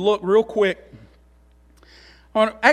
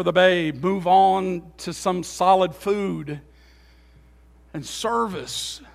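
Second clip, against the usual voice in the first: much softer, slower, intimate preaching; soft sermon appeal; 5 semitones lower, 6 dB quieter, slower than usual. Acted. Preacher urging believers to grow up, soft and slow. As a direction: pull them in close and quiet.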